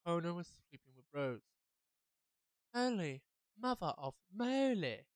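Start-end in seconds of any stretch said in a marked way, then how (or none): 2.7-5.0 slow, higher, drawn-out astonishment; shocked exclamation. Acted. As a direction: stretch each big word in disbelief. Shocked listener reacts to the revelation.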